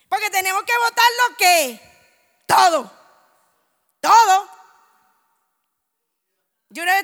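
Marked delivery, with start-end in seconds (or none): 0.1-4.5 louder and louder on "todo"; preaching shout. Real, not acted. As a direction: drive it home with rising force. Preacher urging to throw everything. (predicando a gritos)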